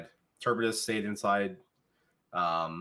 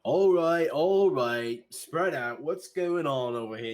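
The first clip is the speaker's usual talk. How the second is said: loud, higher commanding guard voice; young guard; 7 semitones higher, 6 dB louder, slower than usual. Acted. Young guard ordering the crowd apart, loud and higher. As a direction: break up the crowd with authority. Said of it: "walks over".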